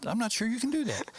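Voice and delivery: put-on high, proper English accent; a polite, doubtful Englishman; fake English accent. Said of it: "my fake English accent".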